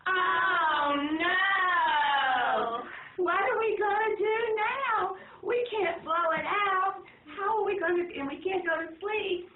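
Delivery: loud, high mock panic; a hammed-up cry of dismay. A narrator's reading. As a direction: wail in playful alarm. Family dismayed, candle won't go out.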